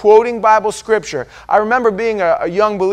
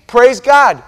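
loud, high burst of praise; approving exclamation; 5 semitones higher, 9 dB louder than usual. Acted. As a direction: cheer it out to the congregation. Cheers each version someone reads.

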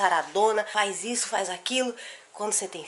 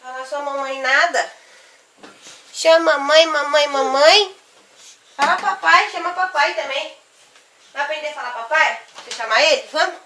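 higher, louder coaxing sing-song; 5 semitones higher, 4 dB louder than usual. Real, not acted. Mother coaxing baby to talk.

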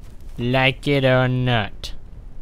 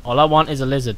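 normal talking voice

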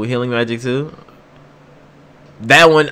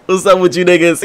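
normal talking voice

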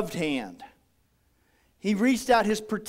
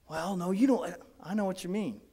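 ordinary talk

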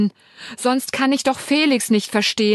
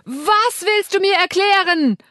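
loud high furious shout; yelling into the phone; 11 semitones higher, 5 dB louder than usual. A narrator's reading. Narrator yelling at her husband on the phone, furious shout. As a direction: yell it down the line at him. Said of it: "brülle ich ins Handy".

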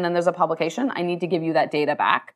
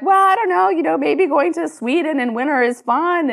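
higher-pitched, upbeat wavering over an invitation; tempted musing; 9 semitones higher, 3 dB louder than usual. Retold or imitated. CEO imitating herself tempted by conference invites, high and eager. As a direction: talk yourself into it in a high voice.

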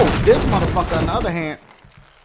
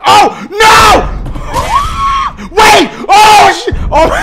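high loud laughing scream; shrieking laugh; 13 semitones higher, 9 dB louder, much slower than usual. Real, not acted. Player dies in the game, laughing and shrieking. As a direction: caught off guard and cracking up.

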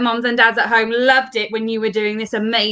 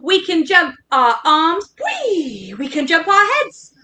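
usual speaking voice

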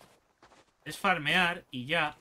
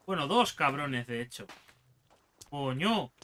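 normal talking voice

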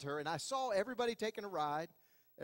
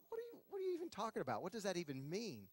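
baffled; 8 dB quieter, faster than usual. Retold or imitated. Baffled inner thought at warning.

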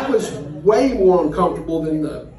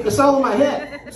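higher-pitched burst of relieved excitement; excited realization; 6 semitones higher than usual. Acted. Man realizing the argument is imagined, excited. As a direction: say it like a sudden happy discovery. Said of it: "So out of excitement".

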